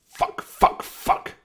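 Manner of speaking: forced, breathy mock yell of curses. A narrator's reading. Ian swearing after she hangs up, hoarse mock yell. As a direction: spit out the curses in frustration. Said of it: "he yelled".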